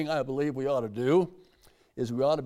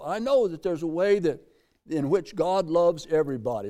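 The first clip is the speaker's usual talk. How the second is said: ordinary talk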